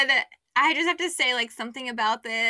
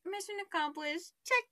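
hushed, higher little aside; secret triumph; 6 semitones higher, 10 dB quieter than usual. Retold or imitated. To herself after he grabbed her hand, hushed triumph. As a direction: say it softly to yourself, pleased.

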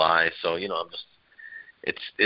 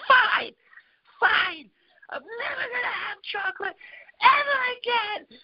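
mock child tantrum cry. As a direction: ham up a little girl's sobbing tantrum. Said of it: "And she goes".

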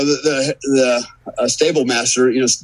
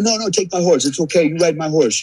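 pitched-up, pushy offer of his horse; insistent stable hand; 6 semitones higher than usual. Retold or imitated. Stable hand insisting the rider take his horse, pitched up.

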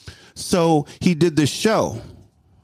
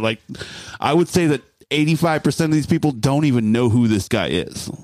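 ordinary talk, nothing marked

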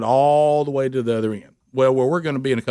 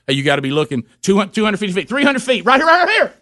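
rising to a high loud right here; building urgency, pointing her in; excited directions; 8 semitones higher, 4 dB louder than usual. Retold or imitated. Husband guiding wife to turn in, rising excitement.

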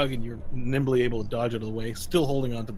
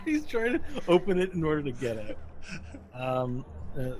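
usual speaking voice

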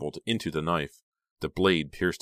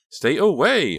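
high, loud shouted warning; 11 semitones higher, 9 dB louder, slower than usual. A narrator's reading. Honey shouting at Bill to stay away. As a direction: shout him back. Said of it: "her voice lowering with menace".

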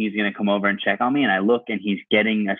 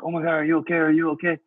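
higher-pitched mock alarm; imitated concerned bystanders; 6 semitones higher than usual. Retelling bystanders checking on a fallen cyclist, worried imitation. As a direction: fuss like worried onlookers. Bystanders voiced after the crash.

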